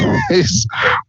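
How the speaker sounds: shrill giggle burst; high squeal laugh. Real, not acted. Laughs at own joke.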